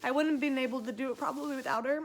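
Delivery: fast, teary, crying voice; real crying while thanking her. Real, not acted. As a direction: push through the sobs. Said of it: "I'm such an ugly crier".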